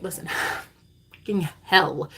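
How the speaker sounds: normal talking voice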